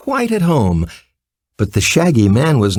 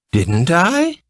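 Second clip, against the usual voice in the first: playful higher question with a smile; merry teasing; 6 semitones higher than usual. A narrator's reading. Ozma teases Dorothy about the party, merry and smiling. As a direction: tease her friend gently. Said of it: "asked Ozma with a merry smile".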